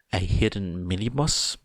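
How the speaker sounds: usual speaking voice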